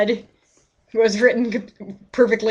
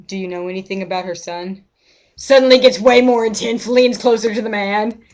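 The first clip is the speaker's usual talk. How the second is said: ordinary talk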